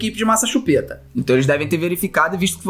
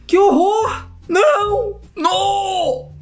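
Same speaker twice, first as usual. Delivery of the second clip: horrified wail; 11 semitones higher, much slower than usual. Real, not acted. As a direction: cry out in horror. Horrified repeated no. (lamento horrorizado)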